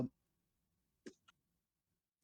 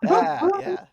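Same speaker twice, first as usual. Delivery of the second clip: embarrassed laugh-out; 7 dB louder than usual. Real, not acted. Embarrassed at word mix-up.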